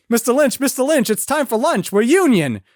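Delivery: high, urgent mock underling voice; hammed-up high-pitched interruption; pleading crew member. Crew member pleading with shouting director.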